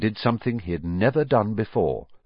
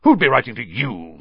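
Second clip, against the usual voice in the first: scornful, higher and louder jab; sneering; 4 semitones higher, 5 dB louder than usual. A narrator's reading. Uncle scorns boy's letter, sneering. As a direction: put the boy down with a sneer. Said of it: "sneered Uncle Vernon".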